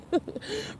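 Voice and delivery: sudden high-pitched giggle. Real, not acted. Catching her own slip on camera, giggling high. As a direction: break into a squeaky laugh.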